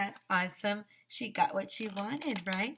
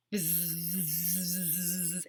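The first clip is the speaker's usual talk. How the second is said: bee buzz. Acted. Colony buzzing for the queen.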